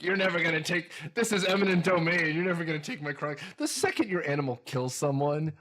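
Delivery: normal talking voice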